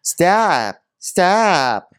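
high yelped command, imitating a screamer; high mock outburst; mock screaming. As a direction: cry it out high, each one falling. Echoing the screamed argument.

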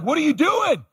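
hammed-up nagging relatives shouting; mock family outcry. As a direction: throw up your hands at his foolishness. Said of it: "My family's like".

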